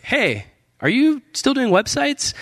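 high, slow, casual caller voice; imitated phone caller. Guy phoning about websites, high-pitched imitated casual greeting.

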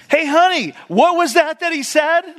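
high, loud mock wife calling across the room; interrupting wife. Wife calling over to her husband across the room, high and loud. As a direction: call out across the church like a nosy wife. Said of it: "totally interrupting".

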